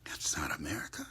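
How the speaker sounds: whispered softly, almost to herself; hushed. Real, not acted. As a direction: breathe it out quietly. Hushed then repeated loud.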